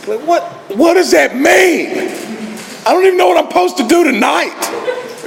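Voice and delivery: very high comic yelp of confusion; exasperated bafflement. Retold or imitated. Newcomer answering stock meeting advice, exasperated bafflement. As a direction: blurt it out, squeaky with bewilderment, near laughter.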